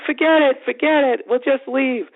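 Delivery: put-on high-pitched, fretful girlfriend voice; imitated freak-out. Girlfriend wants out after the ticket, mock agitation. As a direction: urge him away in a high, agitated voice. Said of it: "So she starts freaking out".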